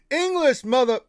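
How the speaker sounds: loud, high, punchy film quote; movie tough-guy quote. Acted. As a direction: shout it like a hammed-up movie line. Quoting a film at the username.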